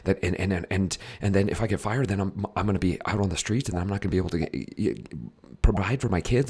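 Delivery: fast, breathless worrying; panicky, quick-tempo chest breathing; anxious rushed talk. Shown as a demonstration. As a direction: rattle off the worries in a hurry. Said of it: "Listen to the tempo of my breath".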